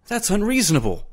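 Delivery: high-pitched objection; desperate protest. A narrator's reading. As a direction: protest as the voice leaps up. Eccles protests a dreadful order.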